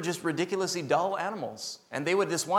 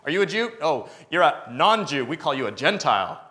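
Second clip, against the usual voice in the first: loud, higher boastful voice of the proud insiders; 4 semitones higher, 7 dB louder than usual. Retold or imitated. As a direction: boast like the chosen people looking down.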